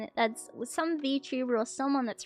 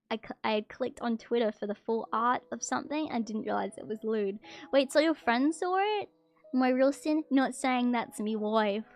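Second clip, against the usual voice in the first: ordinary delivery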